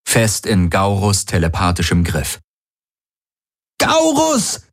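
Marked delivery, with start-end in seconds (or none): 3.8-4.6 high-pitched scream; screamed name. A narrator's reading. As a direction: cry the name out at the top of your range. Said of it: "schrie Kirai". (hoher Schrei)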